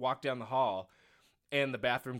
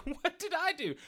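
much higher, baffled squeak; mock-innocent what did I do; mock bewildered; 12 semitones higher than usual. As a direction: play innocent and confused. Retold bewildered reply.

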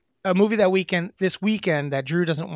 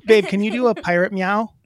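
louder call across the room; 5 semitones higher, 6 dB louder than usual. Real, not acted. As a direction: call out to someone in another room. Calling wife into room.

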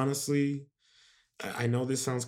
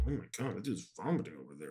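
hushed gawking aside; 4 semitones lower, 8 dB quieter, much faster than usual. Retold or imitated. Kids' remembered reaction to vomiting.

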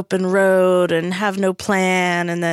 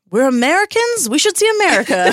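high, hammed-up patriotic dad; Martin; 8 semitones higher, 4 dB louder, faster than usual. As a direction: imitate a dad insisting, higher-pitched. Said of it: "Martin, like a true Marty".

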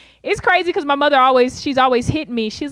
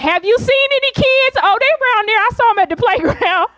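much higher comic play voice; mock asker and answer; 9 semitones higher than usual. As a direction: voice a cheery little skit. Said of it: "They just gon' basically ask me".

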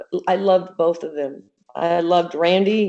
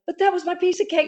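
much higher, fast, playful protest; mock whine; 11 semitones higher, faster than usual. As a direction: whine like a kid losing a treat. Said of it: "but also it was like".

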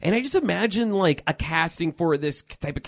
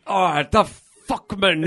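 raised, louder mock-priest warning; old Irish priest; 4 semitones higher, 3 dB louder, slower than usual. Co-host echoing film priest's line, hammed-up warning. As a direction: play up the priest's dread. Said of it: "just the way he says".

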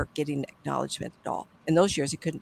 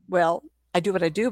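usual speaking voice

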